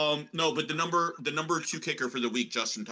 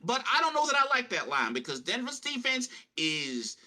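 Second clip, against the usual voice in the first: Deuce Chiggins; 5 semitones higher than usual. Pretend. Co-host rates a rushing bet, prospector voice. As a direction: play the prospector and savor the last word. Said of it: "prospector Deuce Chiggins".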